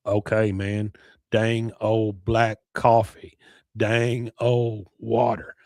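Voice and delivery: very slow, drawn-out words; Boomhauer. Acted. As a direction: stretch each word with gaps between. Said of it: "He just says it slower".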